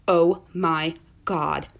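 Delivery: drawn-out, low, word by word; slow realisation. Retold or imitated. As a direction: stretch each word as it sinks in. Repeat as the realisation sinks in.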